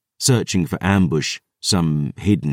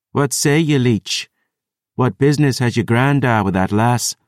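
lightly voiced Highland warrior, higher and challenging; a hint of a gruff clanswoman demanding answers; Dusk Dancer's challenge; 7 semitones higher than usual. Dusk Dancer questions Gabriel.

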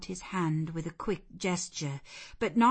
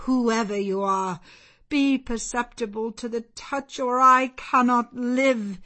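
raised, urgent begging; desperate plea; 5 semitones higher, 9 dB louder than usual. A narrator's reading. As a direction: plead loudly as if life depends on it. Blind man begs to touch her.

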